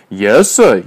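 loud servile shout; mock servant yell. A narrator's reading. Major resumes his servant act, mock yell. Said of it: "yelled Major".

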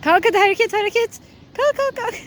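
loud, high, pushy call to get up; urging loudly. Real, not acted. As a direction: hurry them up, loud and high.

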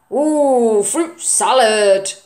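loud, slow, savoured; slow grandpa voice; delighted grandpa. Acted. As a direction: relish the idea.

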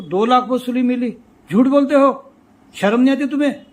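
loud, high retold accusation; angry scolding. SDO accusing the talathi of lying, acted angry scolding. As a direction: shame him at full volume, as the irate officer.

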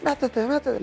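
high, rude pushy order; rude command. Retold or imitated. Soldiers ordering each other in, rudely. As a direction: shove him in with words. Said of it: "les hablan culerísimo".

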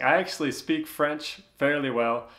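ordinary talk

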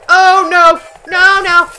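high, loud panicked shout; screaming oh no as it goes wrong; panicked yell. Real, not acted. Panicked cry during crash.

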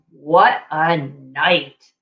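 low, slow muttering mole voice. Acted. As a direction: drag it out low like a grumbling mole. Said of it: "Mole mutters".

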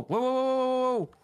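high alarmed whoas. Real, not acted. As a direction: repeat it in alarm. Alarm at the mobs.

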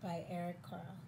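soft, measured story reading; picture-book narration. A narrator's reading. Reader telling children about a very busy spider, storybook narration. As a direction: read it gently to young children.

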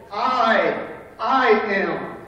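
"I" held out and repeated with weight; emphatic. Shown as a demonstration. Preacher explaining the Greek wording, emphatic stress on "I". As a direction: lean hard on "I", then "I am". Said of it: "But it's emphatic".

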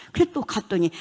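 usual speaking voice

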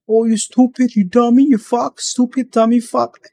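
high, loud childish mock insults; mocking fifth grader. Mocking the customer as a fifth grader throwing insults. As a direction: sneer each insult like a whiny kid. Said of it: "a fucking fifth grader trying to insult people".

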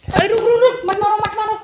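quick repeated calls to hold off; urgent warning. Real, not acted. Vlogger pleading with someone not to hit, urgent.

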